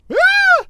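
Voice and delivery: very high, loud sudden scream; startled scream. Acted. Fluttershy startled by Discord behind her, piercing scream. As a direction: shriek as if caught by surprise. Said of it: "Fluttershy screamed".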